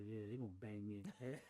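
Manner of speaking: quiet muttered dread. Retold or imitated. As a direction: mutter it to yourself, resigned. Walking out of parole hearing.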